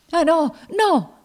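high, loud, slow cry of refusal; horrified protest. Acted. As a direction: cry out to stop her. Tom recoils from planned killing.

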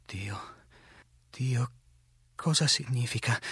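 understated, halting stammer with pauses; balbettio sgomento. A narrator's reading. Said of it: "balbettò accanto a me uno dei pellegrini". (lieve balbettio sgomento, a pause)

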